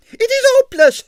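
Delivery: high, loud shrill wail; shrill ballerina. A narrator's reading. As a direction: shriek it out in despair. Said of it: "she shrilled".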